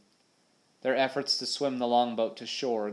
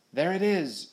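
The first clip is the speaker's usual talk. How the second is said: high, loud cry of discovery; excited raised shout; 7 semitones higher, 5 dB louder than usual. A narrator's reading. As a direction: call out the sighting. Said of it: "shouted Little Richard".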